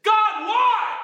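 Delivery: high, loud shout to heaven; anguished outcry. Acted. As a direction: cry it up at God. Paul crying out to God.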